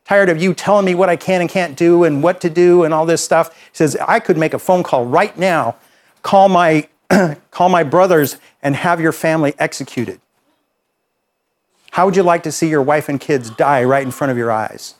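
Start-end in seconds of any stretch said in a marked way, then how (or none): 0.0-3.5 angry threat. Retold or imitated. Young offender in the counselor's face, raised angry voice. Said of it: "finally he gets upset with me". 4.1-14.9 angry threat. Retold or imitated. Young offender in the counselor's face, raised angry voice. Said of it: "finally he gets upset with me".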